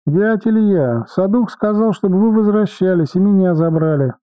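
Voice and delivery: put-on high child voice over radio; child's voice. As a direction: squeak it like a small kid. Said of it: "пропищал детским голосом". (высокий детский голос)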